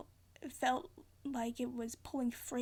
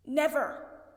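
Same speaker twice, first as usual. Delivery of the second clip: NEVER said firmly, louder; firm refusal; 3 semitones higher, 3 dB louder than usual. A narrator's reading. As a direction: deny it with resolve. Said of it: "he said".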